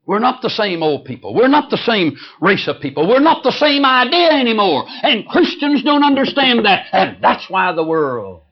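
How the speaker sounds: preacher drives home his point, rising; preaching at full force. Real, not acted. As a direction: build it up like a revival preacher.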